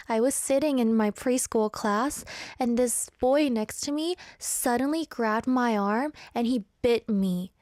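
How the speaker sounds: ordinary talk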